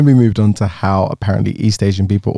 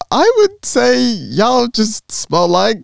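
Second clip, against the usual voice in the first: hesitant; 12 semitones higher, much slower than usual. Retold or imitated. Hesitant answer through laughter.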